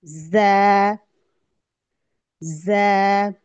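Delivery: जा with a hissing, whistled z; whistling z. Shown as a demonstration. Teacher shows students the whistling z, hissed recitation. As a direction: make a whistle on the ज of जा. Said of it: "सफीर की आवाज आएगी".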